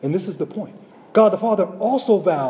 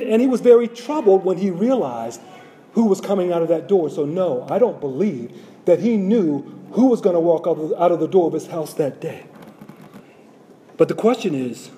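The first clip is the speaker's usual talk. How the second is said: usual speaking voice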